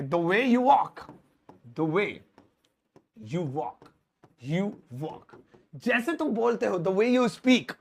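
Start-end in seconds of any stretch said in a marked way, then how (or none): 0.0-5.4 "the way you walk" stretched, then repeated softly; slow teacher read, fading; slow dictation. Shown as a demonstration. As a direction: dictate slowly, echo the end. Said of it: "translation demo".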